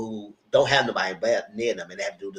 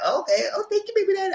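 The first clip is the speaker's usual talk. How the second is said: high-pitched imitation of a grateful woman; mock gushing thanks; faster than usual. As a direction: gush thanks in a put-on high voice. Voicing a grateful expectant mother.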